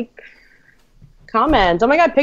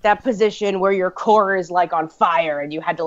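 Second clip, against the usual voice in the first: ordinary talk, nothing marked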